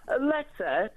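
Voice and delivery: high, rising disbelief; incredulous echo. Real, not acted. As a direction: throw LETTER back as an incredulous question. Caller scoffs at Starmer's letter.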